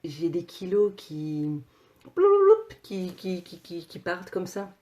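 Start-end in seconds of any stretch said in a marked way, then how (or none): 2.2-2.6 high, loud burbling "bloulouloup"; playful flurry noise. Retold or imitated. Vlogger showing kilos flying off, playful burbled noise. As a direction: make a silly tumbling-away noise.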